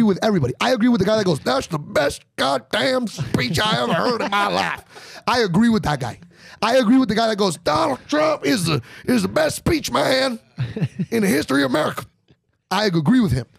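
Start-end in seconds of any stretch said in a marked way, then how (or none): 1.4-5.2 a higher, slow, awed fan voice; hammed-up rally fan in awe of the speech; excited Trump fan. 7.6-12.1 hammed-up fan bellowing praise; shouting Trump fan.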